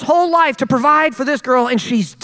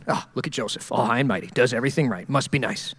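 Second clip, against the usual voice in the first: mocking sneer; 13 semitones lower, 8 dB quieter than usual. Mocking friends sneer at Joseph.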